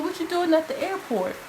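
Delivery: surprised grandma. Retold or imitated. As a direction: ask it like a startled grandmother.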